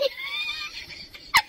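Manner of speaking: high-pitched helpless laugh; real laugh. Real, not acted. Host cracked up by Stan, laughing. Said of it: "stop making me laugh".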